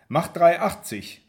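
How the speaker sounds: loud, slow, fed-up demand; annoyed barmaid. A narrator's reading. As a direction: bark the price at him, impatient. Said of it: "sieht mich genervt an".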